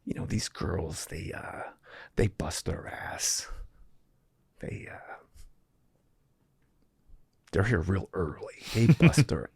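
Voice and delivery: low, hushed imitation of Tom Cruise; hushed Tom Cruise impression. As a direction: lean in and confide it softly like Cruise. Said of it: "and Tom Cruise goes".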